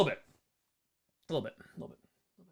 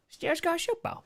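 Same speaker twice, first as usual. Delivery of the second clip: funny voice; 11 semitones higher than usual. Pretend. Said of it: "That's all you need to know".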